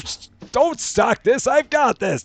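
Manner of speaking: mock angry, high and pushed; hammed-up angry boss bark. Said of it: "That's my angry voice".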